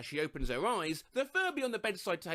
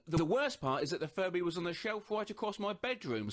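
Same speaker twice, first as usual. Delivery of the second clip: ordinary talk, nothing marked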